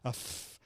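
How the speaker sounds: soft unvoiced f breath; voiceless f sound. Shown as a demonstration. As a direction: let the air hiss out on f. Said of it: "breathe out to these different sounds".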